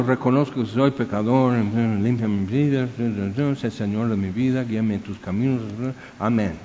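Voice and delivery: a slightly hushed rote prayer; recited prayer. Retold or imitated. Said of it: "así".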